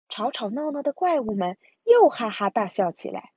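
plain reading voice

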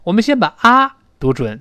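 normal talking voice

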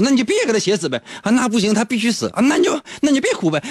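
high, fast exasperated back-and-forth; mock quarrel. Friend and Flaubert bickering, exasperated. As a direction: bicker quickly.

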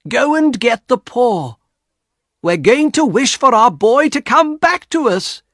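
high, loud, fervent orders; urgent hope. A narrator's reading. Wife plans wish for boy's return, fervent and urgent. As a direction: build to a fervent declaration.

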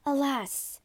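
high, dismayed cry; whimpering. A narrator's reading. Wife learns her witness is being killed, whimpering. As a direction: whimper it, pitch lifted. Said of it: "whimpered Desdemona".

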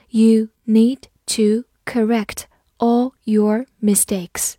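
slow, word-by-word model reading. Shown as a demonstration. Teacher reads the example sentence for learners, slowly, word by word. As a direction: read it slowly, a gap after each word. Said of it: "好，慢读一遍".

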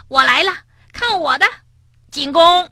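high, eager charge; playful battle cry. Acted. Frog charging the rabbit in a snowball fight, playful battle cry.

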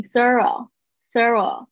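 slow "thorough" ending in an "oh"; careful "thorough". Shown as a demonstration. Teacher models for students, slow careful "thorough". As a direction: drag out "thorough", rounding the final O. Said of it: "哦的声音".

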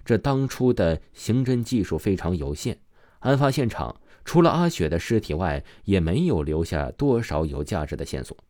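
plain reading voice

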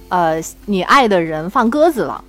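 ordinary talk, nothing marked

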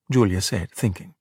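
plain reading voice

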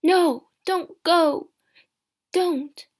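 slow, high, loud plea; drawn-out cry of protest; desperate cry. A narrator's reading. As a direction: beg her loudly, drawing out each word. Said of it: "her husband cried again".

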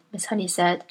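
plain reading voice

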